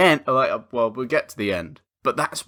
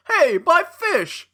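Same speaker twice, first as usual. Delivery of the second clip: high, drawn-out cry over a lost fish; hammed-up film character calling out; mock panicked cry; 14 semitones higher, slower than usual. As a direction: wail it in mock alarm. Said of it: "And he goes like".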